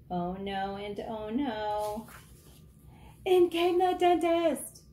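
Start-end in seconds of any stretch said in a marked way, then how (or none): 3.2-4.8 higher, louder grand entrance; dramatic announcement. A narrator's reading. Narrator introducing the dentist, a loud high announcement. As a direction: announce it like a fanfare.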